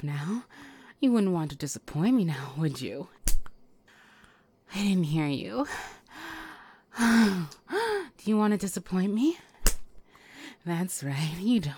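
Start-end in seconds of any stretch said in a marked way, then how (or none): none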